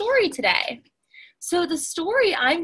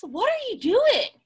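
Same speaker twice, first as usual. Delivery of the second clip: high fox voice, much higher; curious fox; 9 semitones higher than usual. Acted. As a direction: fox asks politely.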